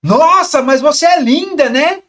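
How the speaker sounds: very loud, high-pitched shouted compliment. Shown as a demonstration. Coach demonstrates how volume changes the message, loud shout. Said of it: "Volume que você conversa".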